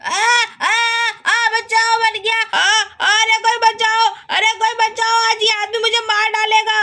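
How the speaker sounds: high-pitched wailing for help; yelp, then beg anyone for rescue; hammed-up panicked plea. कबाड़ी मार खाते हुए चिल्लाता. (घबराकर मदद की गुहार)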